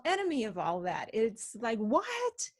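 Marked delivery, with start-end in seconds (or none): 1.8-2.5 high-pitched incredulous "what?"; baffled. Acted. Fitness trainer reacting to the idea of surrendering, baffled. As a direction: yelp it in disbelief.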